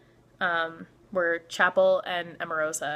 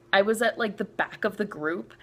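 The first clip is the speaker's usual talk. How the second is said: ordinary talk, nothing marked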